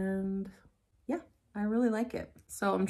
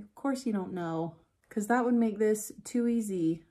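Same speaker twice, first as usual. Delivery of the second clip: ordinary talk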